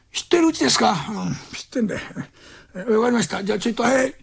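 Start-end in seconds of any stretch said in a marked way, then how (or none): 1.1-2.0 soft unvoiced whisper. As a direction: whisper it, not to be heard. Drunk whispers he knows house. (酔っ払いのひそひそ声)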